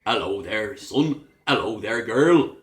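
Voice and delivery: rhotic West Country greeting; broad Cornish, every final R sounded; Cornish accent. Shown as a demonstration. Teacher showing Cornwall's R's at word ends, Cornish accent. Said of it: "They always pronounce their R's".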